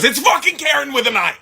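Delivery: high, indignant imitation; mock exasperated correction. Girlfriend correcting her name to the roommate, mock exasperation.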